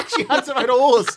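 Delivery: high, loud laughing line; laughing disbelief. Real, not acted. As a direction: say it while cracking up. Laughing at the horse story.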